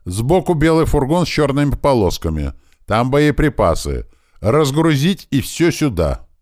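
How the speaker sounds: high, loud, sustained command; shouted orders. A narrator's reading. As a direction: call the instructions out across a crowd. Tuman shouting orders across the crowd. (громкие приказы на повышенных тонах)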